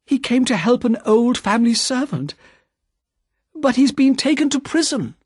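high, rising distress as she explains; pleading, near tears but held; anxious cry. A narrator's reading. Lucy explains Charles's arrest to Mister Lorry, distraught. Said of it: "cried Lucy".

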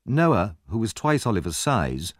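plain reading voice

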